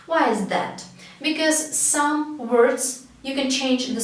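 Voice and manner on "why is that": normal talking voice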